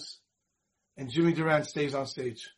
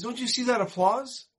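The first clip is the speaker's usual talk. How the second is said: higher, insistent look-at-this; excited nudge to look; lightly acted eager performer; eager urging; 6 semitones higher than usual. Jimmy insisting.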